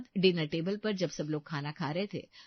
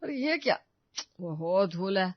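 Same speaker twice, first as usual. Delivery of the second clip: flustered. Acted. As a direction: caught off guard, voice jumps up. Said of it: "वो हड़बड़ा गया". (हड़बड़ाकर चौंकते हुए)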